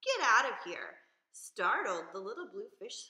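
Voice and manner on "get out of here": loud, sharp get out; loud angry order; angry dismissal. Acted. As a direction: shout him off, proud and cross. Rainbow Fish drives fish away.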